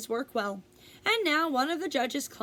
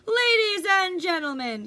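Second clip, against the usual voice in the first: loud, higher announcer call to the crowd; loudspeaker announcer; 7 semitones higher, 6 dB louder than usual. Acted. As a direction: boom it out over a fair loudspeaker.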